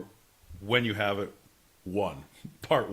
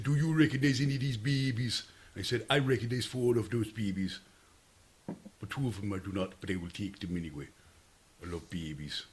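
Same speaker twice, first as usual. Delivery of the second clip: put-on Jamaican accent; 6 semitones lower, 8 dB quieter than usual. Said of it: "can we still do Jamaican accents".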